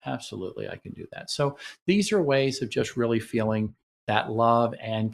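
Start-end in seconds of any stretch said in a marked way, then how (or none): 0.1-1.3 soft reassurance. Shown as a demonstration. Partner reassures softly.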